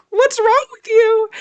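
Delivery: high, loud, slow mock puzzlement; mock baffled voice. Retelling the assistant's imagined reaction, high mock puzzlement. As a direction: play the baffled assistant, squeaky and drawn out.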